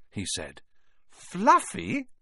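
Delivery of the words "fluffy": surprised question. Acted. Kids surprised.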